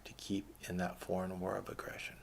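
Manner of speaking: drops to a whisper for the last words. Real, not acted. Ranting vlogger dropping to a whisper on war. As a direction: let the line sink into a hushed whisper. Sudden drop mid-sentence.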